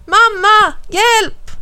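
put-on high-pitched, loud child's plea; calling for help. A narrator's reading. Alf calls his mum for help, calling out. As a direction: shout urgently for a parent. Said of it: "ropar Alf".

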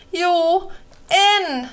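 high, urgent cry; boy shrieking back at his doubting friend; shrieked. Acted. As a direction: insist with a frightened yelp. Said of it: "skrek Larsan".

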